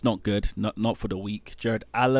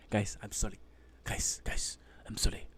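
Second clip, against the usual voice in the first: whispered, half-laughing plea to the audience; sheepish whisper; 2 semitones lower, 11 dB quieter than usual. Real, not acted. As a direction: whisper it like you're caught giggling. Giggling apology to viewers.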